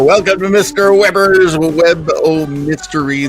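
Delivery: usual speaking voice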